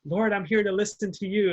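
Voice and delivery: nun loses patience, calling out to the Lord; exasperated plea. Retold or imitated. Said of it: "she's like".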